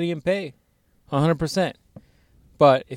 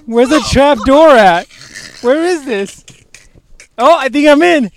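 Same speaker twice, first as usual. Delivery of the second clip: high, loud, puzzled fumbling about; mock bewildered searching, pitched high and loud; mock confused fumbling; 8 semitones higher, 7 dB louder than usual. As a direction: play it like a man lost in the folds, voice up high. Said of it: "I'm like, I'm like".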